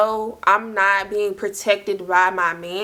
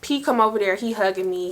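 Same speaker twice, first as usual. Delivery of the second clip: usual speaking voice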